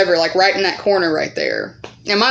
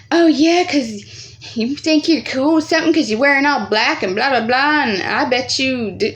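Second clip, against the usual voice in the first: mocking taunt; 5 semitones higher than usual. Bully mocking narrator's clothes.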